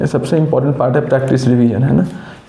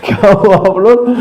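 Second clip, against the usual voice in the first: laughing question; 5 semitones higher, 4 dB louder than usual. Real, not acted. Teasing students as horses.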